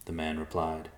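ordinary delivery

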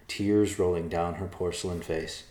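usual speaking voice

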